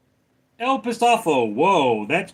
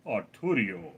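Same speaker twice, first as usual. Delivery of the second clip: lower, softer, slow robotic Arturo with a Mexican accent; 8 semitones lower, 7 dB quieter than usual. Shown as a demonstration. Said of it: "say Arturo slowly".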